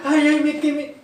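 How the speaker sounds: high, hammed-up "ay, ay" of pain; mock burned cry. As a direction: play someone who just got burned. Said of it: "uno está". (un quejido exagerado de quemadura, agudo)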